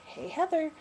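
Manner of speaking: high, sing-song mock of the mother-in-law; mimicking mother-in-law. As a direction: lift the voice into her airy greeting. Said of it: "and she said".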